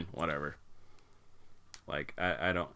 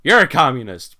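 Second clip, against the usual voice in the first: mock accusing shout; 4 semitones higher, 12 dB louder than usual. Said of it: "it's just like".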